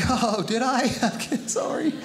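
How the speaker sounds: high, giggling sorry; giggly mock apology; laughing fake apology. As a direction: apologize while still laughing. Caught laughing at son.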